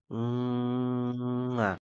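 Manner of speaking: long, drawn-out "mwaaah"; big kiss sound. A narrator's reading. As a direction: stretch out one big smooching kiss. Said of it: "सर्वात मोठी किस स्टमकवर करून".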